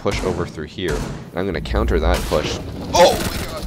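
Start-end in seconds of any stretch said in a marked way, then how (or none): 2.9-3.7 sudden high yelp of surprise; excited shout. Real, not acted. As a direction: blurt it out, thrilled.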